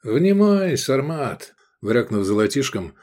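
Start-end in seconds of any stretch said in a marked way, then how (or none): 0.0-1.5 higher-pitched lecturing opener; mentor tone. A narrator's reading. Искандер begins teaching Эдик about coins, in a mentor's tone. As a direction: summon the pupil's attention like a mentor. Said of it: "он заговорил менторским тоном".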